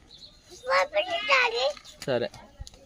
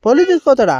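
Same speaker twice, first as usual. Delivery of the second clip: higher, louder incredulous echo; surprised question; 9 semitones higher, 6 dB louder than usual. Real, not acted. Parent echoing the child's police warning, surprised. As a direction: ask it back in loud, high surprise.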